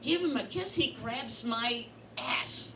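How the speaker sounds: normal talking voice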